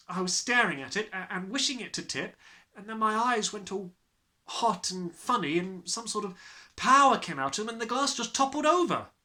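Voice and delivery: rushing to tell the wonder; excited child. Acted. Matilda describing her power to Miss Honey, rising excitement.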